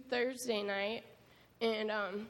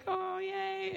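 "oh, yay" squealed high; happy; 9 semitones higher than usual. Retold or imitated. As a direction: let the joy pop out on top. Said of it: "I was so happy".

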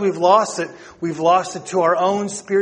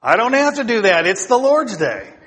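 loud, high, playful protest; mock defiant refusal; 6 semitones higher, 5 dB louder than usual. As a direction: refuse chores with comic indignation, stretching the last words. Acting wife's refusal to husband.

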